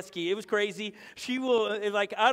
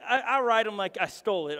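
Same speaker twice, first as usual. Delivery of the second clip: ordinary delivery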